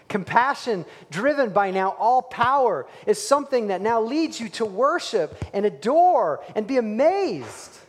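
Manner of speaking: fervent preaching rise; preaching at full force. Real, not acted. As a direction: lift it to a ringing peak. Preaching climax.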